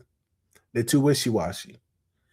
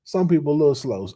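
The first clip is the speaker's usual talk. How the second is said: ordinary delivery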